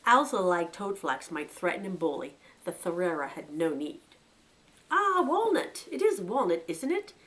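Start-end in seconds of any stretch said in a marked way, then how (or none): 4.9-7.1 a hint of a genial old chief, high and pleased; lightly voiced chief rabbit. Old chief rabbit welcoming a visitor, lightly voiced. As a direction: greet the guest with easy condescension.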